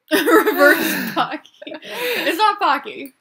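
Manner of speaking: slow, high laughing correction. Real, not acted. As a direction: laugh the words out. Laughing at name.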